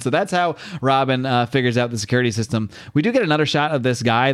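normal talking voice

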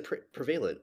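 "prevalent" said pre-VAY-lunt; habitual mispronunciation. Real, not acted. [name] defending his habit, "prevalent" as pre-VAY-lunt. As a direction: stress VAY in "prevalent", after a false start. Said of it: "I've always said".

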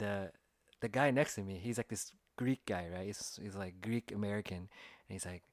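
usual speaking voice

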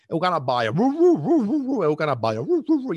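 high, loud woo-woo noises; mock dog barking. Owner's dog barking away, high mock barks. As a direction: yap like an over-excited dog.

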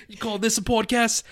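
put-on high-pitched parody Aussie accent; Australian accent. Host parodying a knife line for laughs, mock Australian accent. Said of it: "in my best Australian accent".

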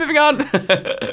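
slow, drawn-out moving on through a laugh; dragged out slowly. Real, not acted. Co-host shutting down the age joke, slow and drawn out. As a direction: stretch moving on way out, still laughing. Laughing off being called old.

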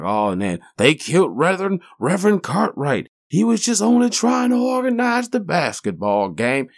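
high, overdone preachy lament; mock preachy. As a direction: ham up a preachy eulogy. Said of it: "It doesn't have, like".

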